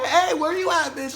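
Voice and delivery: high, loud call through a house; acted-out holler, pitch way up; retold yelling. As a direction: yell out for a missing friend. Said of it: "yelling for this bitch".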